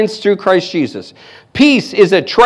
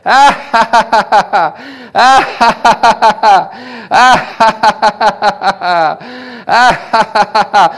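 imitated laugh. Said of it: "And he would go".

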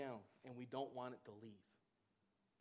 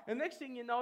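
usual speaking voice

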